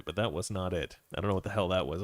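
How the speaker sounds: normal talking voice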